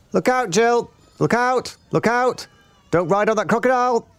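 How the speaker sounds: very high singsong warning; Roly-Poly Bird. A narrator's reading. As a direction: chirp the alarm like a frantic bird.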